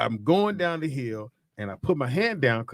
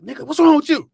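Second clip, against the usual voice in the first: much higher, louder imitated yell of a furious dad; angry dad; 14 semitones higher, 10 dB louder than usual. Dad yelling at his son who broke his arm, imitated angry shout. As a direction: bark it like the angry father. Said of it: "He said".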